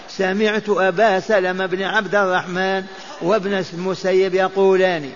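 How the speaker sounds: normal talking voice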